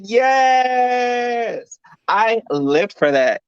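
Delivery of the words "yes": shouted yes. Retold or imitated. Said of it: "I said".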